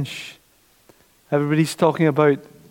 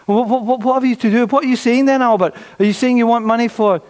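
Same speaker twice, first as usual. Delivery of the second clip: higher, louder mock-indignant listener; suspicious questioning; 6 semitones higher, 5 dB louder than usual. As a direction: fire the questions like a wary congregant. Congregation voiced, suspicious questioning.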